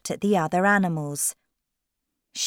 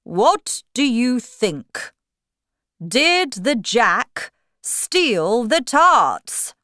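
loud, slow, raised king's voice; storybook king; 6 semitones higher, 7 dB louder, slower than usual. Acted. King questioning Bill and the jurors, loud regal voice. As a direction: question them loudly and slowly as the king. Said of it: "the king said to Bill".

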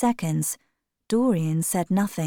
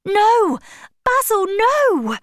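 much higher, loud panicked cry; desperate shout; 7 dB louder than usual. A narrator's reading. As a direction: scream to stop the knife. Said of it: "he shouted".

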